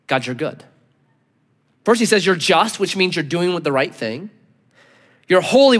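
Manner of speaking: usual speaking voice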